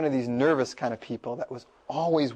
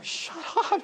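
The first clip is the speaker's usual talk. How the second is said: very high, laughing mock exasperation; exasperated classmates, imitated; 4 dB quieter than usual. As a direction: play an impatient class, half laughing. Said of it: "everybody in the class is going".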